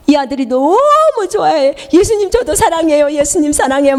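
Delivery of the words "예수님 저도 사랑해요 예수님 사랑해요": tearful joy. Retold or imitated. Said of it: "막 울면서".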